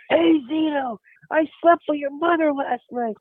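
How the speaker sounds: high-pitched mock yell; imitated heckler. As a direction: taunt the player like a fan in the stands. Heckling fan leaning over the rail.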